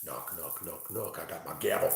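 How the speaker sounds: lightly voiced judge, low and soft; imagined judge. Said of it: "It's, it's Shalimar who's in timeout. He's like".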